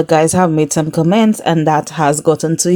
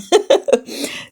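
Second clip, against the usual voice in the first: high giggle, soft and trailing off; real laugh; 12 semitones higher, 4 dB quieter than usual. Real, not acted. Hoping for two guy bombshells, giggling. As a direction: giggle at your own wish.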